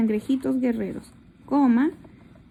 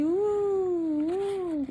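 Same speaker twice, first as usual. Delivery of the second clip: high rocking uh-uh sounds; soft rocking hum; swaying hum; 6 semitones higher, 4 dB quieter than usual. Shown as a demonstration. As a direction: croon a rocking uh, uh. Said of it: "les hacía así".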